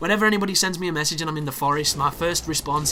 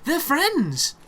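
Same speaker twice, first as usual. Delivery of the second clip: much higher, outraged and incredulous; indignant high pitch; 11 semitones higher, 3 dB louder than usual. Real, not acted. Gamer outraged that two enemies are friends, voice up high. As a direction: squeal it, scandalized they team up.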